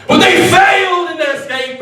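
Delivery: high, loud dramatic wail; dramatic cry. Acted. Waiter mourning glasses running for freedom, dramatic cry. Climax of the dropped tray story.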